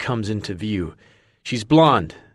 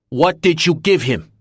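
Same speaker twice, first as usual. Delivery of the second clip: angry shout; 7 semitones higher, 5 dB louder than usual. A narrator's reading. Friend confronting woman angrily.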